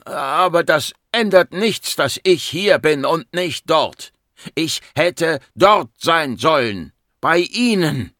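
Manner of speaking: slow, high, loud and emphatic; anguished outburst. Acted. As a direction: drive out each word in grief and anger. Grieving patient's outburst at doctor. (verzweifelt, langsam und laut)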